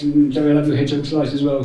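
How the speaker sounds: ordinary talk, nothing marked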